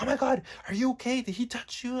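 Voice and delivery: high, fast worried crowd voice; imitating the panicked staff, high and fast; high, hurried mock concern; alarmed bystanders.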